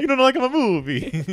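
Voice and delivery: high, laughing cartoon count voice; mock offended vampire, breaking into laughs; Count Chocula. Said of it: "I thought I was talking like Count Chocula".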